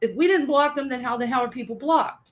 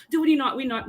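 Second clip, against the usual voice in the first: normal talking voice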